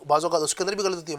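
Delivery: usual speaking voice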